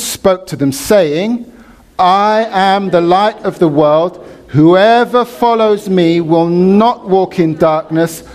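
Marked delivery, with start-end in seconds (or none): 2.0-8.2 slow, raised proclamation for all to join; ringing, deliberate group recitation; congregational scripture reading. Real, not acted. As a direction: lead the room in reciting it, high and measured. Leads congregation reading scripture aloud together.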